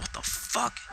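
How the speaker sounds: breathy whisper, well under speaking level; hushed disbelief. Retold or imitated. Both checking behind the house, hushed disbelief. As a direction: whisper it as a stunned re-enacted reaction.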